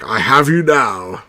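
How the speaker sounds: slow low Vader quote; lightly imitating Darth Vader, slow; a hint of Darth Vader, slow and low; Vader line. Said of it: "When he goes like".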